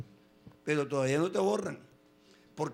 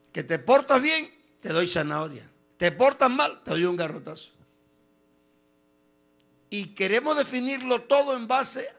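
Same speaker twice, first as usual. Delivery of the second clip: loud, high preaching at full force; rising pulpit cry; fiery preaching; 5 semitones higher, 9 dB louder than usual. Acted. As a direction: preach it out to the congregation.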